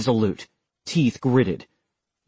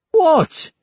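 much higher, louder mock-innocent what; innocent exclamation; 6 dB louder than usual. McLaughlin feigns innocence toward the other soldier with a high exclamation. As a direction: play innocent, caught out. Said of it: "McLaughlin exclaimed innocently".

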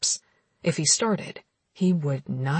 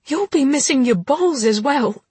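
angry; 8 semitones higher, 6 dB louder than usual. Acted. Heroine threatens him.

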